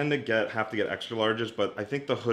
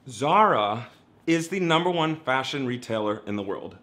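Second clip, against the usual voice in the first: ordinary talk, nothing marked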